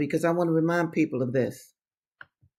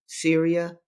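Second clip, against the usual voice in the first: ordinary talk, nothing marked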